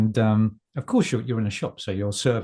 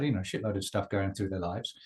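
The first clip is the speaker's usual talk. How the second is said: usual speaking voice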